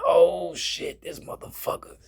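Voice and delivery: high strained then whispered curse; pained strained whisper. Shown as a demonstration. Showing how he could barely talk after the injury, strained whisper. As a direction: choke it out through the pain. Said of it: "keep your voice like this".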